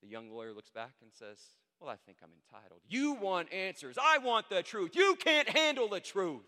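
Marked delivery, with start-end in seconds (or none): 1.8-2.8 low, soft, unruffled reply; calm young lawyer standing firm; unmoved. Acted. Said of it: "And unmoved". 2.9-6.4 full-volume, high, explosive outburst; hammed-up movie showdown yelling; furious courtroom shout. Acted courtroom shouting match from film.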